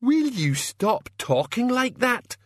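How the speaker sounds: very high, drawn-out exasperated yell; strained outburst of temper; angry shout. A narrator's reading. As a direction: shout them down, at your wits' end. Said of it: "shouted Medium Dave".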